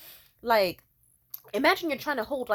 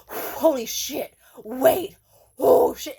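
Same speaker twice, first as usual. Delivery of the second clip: retold frantic cry; 3 semitones higher, 8 dB louder, much slower than usual. Boyfriend overwhelmed, retold.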